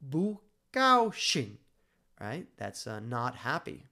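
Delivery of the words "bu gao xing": bu gao xing said very slowly, much higher and louder, tones exaggerated; Chinese phrase demo. Shown as a demonstration. Teacher exaggerating bu gao xing for learners, slow, high and loud. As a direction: stretch out bu gao xing, hitting each tone hard. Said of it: "so it would be just".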